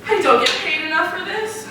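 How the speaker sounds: ordinary delivery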